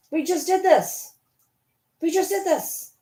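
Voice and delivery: weary mock despair at the dog; exasperated. Real, not acted. Owner exasperated with her dog going in and out. Exasperated at dog's door game.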